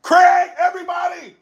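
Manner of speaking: high, loud alarm; warning Craig in a loud high voice; mock paranoid shout. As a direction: yell out the threats around you. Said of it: "I'm like".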